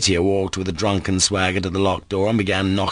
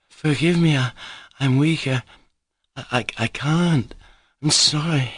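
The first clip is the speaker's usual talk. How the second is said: higher, faltering weak apology; faint Grushenka; 7 semitones higher than usual. Acted. Grushenka apologising to the chorus, faint and weak. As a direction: stammer it out, nearly collapsing.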